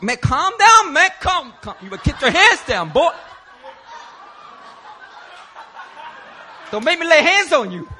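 loud, high, laughing yell to calm down; half-joking shout with a threat underneath; forceful warning. Retold or imitated. Pastor shouting at the man who's about to punch him. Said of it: "I was like".

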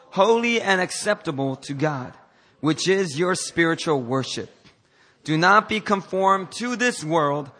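slow, measured read-aloud; scripture reading. Acted. Preacher leading the congregation in reading together, scripture reading. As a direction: read it out deliberately for everyone to follow.

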